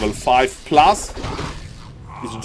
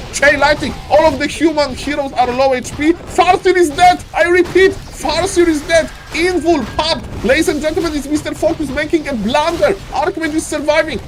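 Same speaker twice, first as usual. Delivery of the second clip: excited play-by-play; 10 semitones higher than usual. Real, not acted. Caster shouting the big teamfight to viewers, frantic and higher. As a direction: call every kill like the game's on the line.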